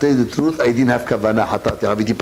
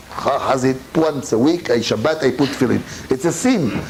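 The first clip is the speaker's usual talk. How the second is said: ordinary delivery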